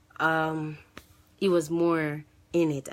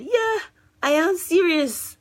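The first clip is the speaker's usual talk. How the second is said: emphatic disbelief; 13 semitones higher, 6 dB louder, slower than usual. Retold or imitated. Confirming shocking news to girlfriend.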